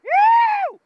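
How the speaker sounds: high, loud whoop of real thrill; sudden joyful yell; excited whoop. Real, not acted. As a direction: let out a whoop as the fish takes line. Fish hooked, reel screaming.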